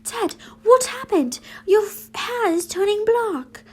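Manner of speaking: high, loud, alarmed small voice; a worried smaller boy crying out; small Scorpius voice. Acted. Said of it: "Scorpius having a little small voice".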